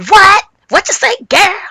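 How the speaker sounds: loud, high-pitched outburst; mock high, loud reaction; perked up. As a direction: perk right up and butt in loudly. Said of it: "Jackie perked up and said".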